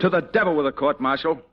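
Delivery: loud, high defiant snap; angry retort. Acted. Soldier to his general, loud and defiant. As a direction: throw the court-martial back in his face.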